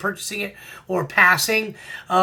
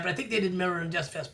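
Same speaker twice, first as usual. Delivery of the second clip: quieter, quicker hedged repeat; unsure hedge; 8 dB quieter, faster than usual. Real, not acted. After admitting he could be wrong, a quick soft hedge. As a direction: back off it, softer and fast.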